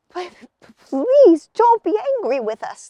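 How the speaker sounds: high, loud frightened plea; pleading. Acted. Dizzy boys begging the angry gnomes, high and scared. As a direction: beg the gnomes not to be cross.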